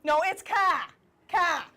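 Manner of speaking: car with no r, a broad open ah; kah, the Boston way. Shown as a demonstration. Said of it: "How do you say it?".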